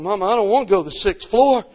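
put-on high, loud, whiny child's plea; pleading little boy. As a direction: beg your mother like a scared kid. Boy begging his mother.

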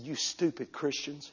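soft, slow sneer; sneering man. Retold or imitated. Preacher quoting the man calling Christians stupid, soft sneer. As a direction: drag it out contemptuously. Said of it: "He said".

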